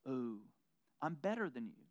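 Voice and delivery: hushed, smug little gloat under the breath; smug whisper. Retold or imitated. Preacher mocking a smug churchgoer, quiet gloating. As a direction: gloat softly, pleased with yourself.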